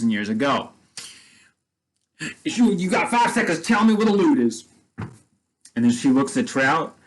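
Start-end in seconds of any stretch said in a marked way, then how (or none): 2.4-4.6 higher, put-on outlaw voice demanding the loot; a hammed-up bandit giving a five-second ultimatum; threatening gunman.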